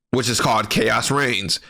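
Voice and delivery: ordinary talk, nothing marked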